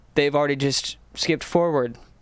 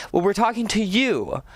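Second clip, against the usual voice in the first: ad announcer; 6 semitones higher than usual. Acted. Announcer pitch to viewers.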